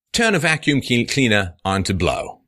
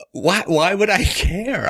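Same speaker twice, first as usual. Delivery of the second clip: incredulous; 9 semitones higher, slower than usual. Pretend. Mocking disbelief.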